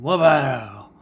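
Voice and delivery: big playful exclamation; shouted wow. Pretend. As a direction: yell it out as the car drives past.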